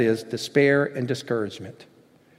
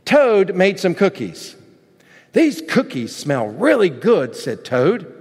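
animated storybook reading, higher and lilting; story narration; 5 semitones higher, 3 dB louder than usual. Acted. Preacher reads a children's story to the congregation, animated storytelling. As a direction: read it like a children's tale.

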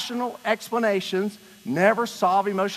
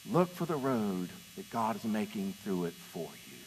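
soft plea; 8 semitones lower, 7 dB quieter than usual. Real, not acted. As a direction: drop low and soft, an appeal to choose. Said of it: "It's a choice".

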